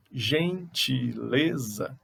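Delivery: "Gentileza" drawn out very slowly; syllables counted out. Shown as a demonstration. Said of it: "Ingredientes tem mais de três". ("Gentileza" bem devagar, contando as sílabas)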